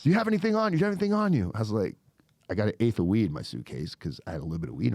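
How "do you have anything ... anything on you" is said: high-pitched rapid-fire mock interrogation; imitated urgent questioning. Agents search the arrested man, imitated urgent questioning. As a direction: repeat it urgently, pitched well up.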